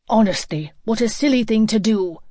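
loud, exasperated scolding; blazing. A narrator's reading. Nick scolds Joey for running away, blazing. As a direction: flare up at a foolish friend. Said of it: "Nick blazed at him".

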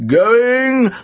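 high, stretched saleroom call; ringing, drawn-out auctioneer's cry. A narrator's reading. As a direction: call it out to close the sale. Auctioneer presses on to close sale.

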